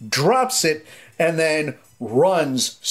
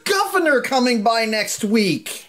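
put-on snobby kid; 13 semitones higher, slower than usual.

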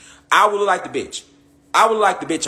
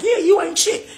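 mocking high voice.

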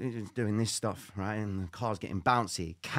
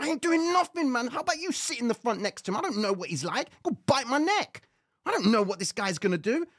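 high-pitched frantic refusal; imitated squealing objection to the cousin; panicky protest; 11 semitones higher, 3 dB louder than usual. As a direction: argue back in a high, hammed-up panic. Acted panicky argument with cousin.

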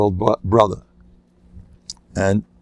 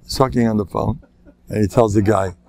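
ordinary talk

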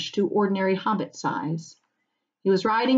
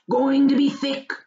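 rustic farmer, higher and louder; old farmer voice; 7 semitones higher, 4 dB louder than usual. Acted. Farmer warns the hobbits about fog, rustic voice. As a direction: weigh up the fog like a country farmer. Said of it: "said Maggot".

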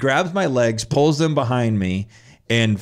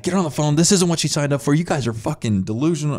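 raised, higher-pitched retold ranting; erratic outburst; 7 semitones higher than usual. Kid demanding the staff call his mom, erratic retold shouting. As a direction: rant at the staff like a panicking kid. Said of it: "So I start going erratic".

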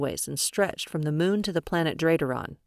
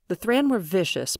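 usual speaking voice

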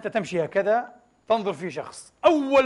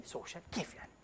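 whispered aside; 3 semitones lower, 20 dB quieter, faster than usual. Real, not acted. Preacher puzzling over the English term, whispered.

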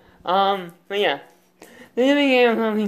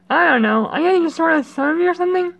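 character voice; 9 semitones higher than usual. Pretend. Voicing game character.

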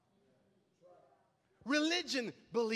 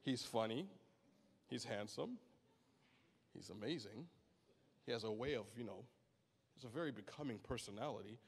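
lower, much softer, musing God; mock God voice; 10 dB quieter than usual. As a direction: gush softly about Kevin. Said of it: "he's like".

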